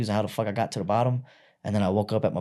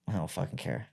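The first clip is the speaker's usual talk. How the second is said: nonchalant; 5 semitones lower, 11 dB quieter than usual. Retold or imitated. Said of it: "I was just so nonchalant".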